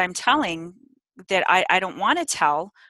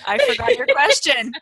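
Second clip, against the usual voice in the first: laughing uncontrollably; can't stop laughing; hysterical laugh; 10 semitones higher, 4 dB louder than usual. Real, not acted. Laughing fit after blackout.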